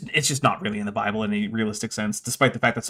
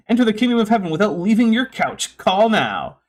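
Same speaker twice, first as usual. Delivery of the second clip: infomercial voice; 8 semitones higher, 5 dB louder than usual. Acted. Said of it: "Sola Fide sounds like an infomercial".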